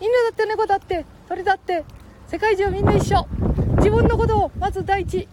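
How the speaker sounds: put-on high squeaky boy voice; Ken-chan voice.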